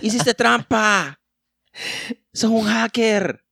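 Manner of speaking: very slow, high squeaky whine; slow mock whine. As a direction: drag out the accusation like a sulking kid. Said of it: "repeated slower".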